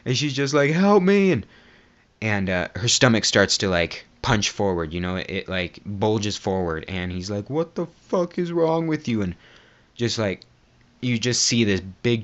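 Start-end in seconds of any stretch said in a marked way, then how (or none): 0.7-1.3 high, loud imitated cry for help; mock desperate plea. Acts trapped woman begging for help. 7.5-9.2 mock appalled disbelief. Acts man appalled by the woman.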